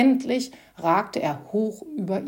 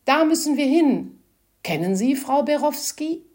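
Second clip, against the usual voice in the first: higher, louder pleading plea; pleading man; 7 semitones higher, 5 dB louder than usual. A narrator's reading. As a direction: beg urgently for help. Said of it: "Seine Stimme klang flehend". (flehend, lauter und höher)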